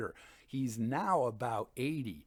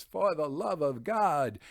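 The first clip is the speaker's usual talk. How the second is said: higher, louder mock plea; a hammed-up desperate plea, higher and louder; panicked pleading; 5 semitones higher, 7 dB louder than usual. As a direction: beg for mercy as the doomed man. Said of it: "a panicked Fortunato pleads".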